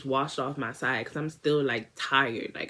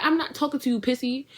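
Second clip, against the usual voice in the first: indignant refusal; 8 semitones higher than usual. Retold or imitated. Rejecting the friend.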